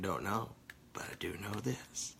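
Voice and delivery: breathy whisper, almost no voice; soft conspiratorial whisper. Shown as a demonstration. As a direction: whisper it like a secret. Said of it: "I can keep saying".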